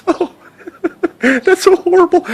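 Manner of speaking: high, cracked mock sob; mock teary wail. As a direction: overplay a drunk crying at a sitcom. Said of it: "and I'd be like".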